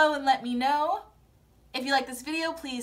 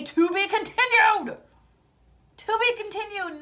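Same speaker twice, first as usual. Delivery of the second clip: mock outrage; 6 semitones higher, 4 dB louder than usual. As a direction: wail the words in playful disbelief.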